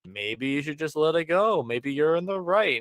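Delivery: slow, drawn-out passive-aggressive advice; mock-helpful singsong. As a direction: stretch each phrase with a pointed rising lilt. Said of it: "the most passive-aggressive advice".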